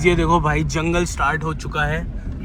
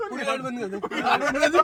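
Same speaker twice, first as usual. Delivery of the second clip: laughing; 7 semitones higher, 4 dB quieter, faster than usual. Real, not acted. Laughing while repeating request.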